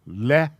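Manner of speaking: short le with a schwa; syllable le. Shown as a demonstration. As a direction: model le slowly. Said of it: "this is pronounced".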